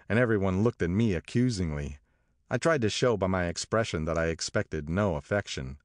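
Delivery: usual speaking voice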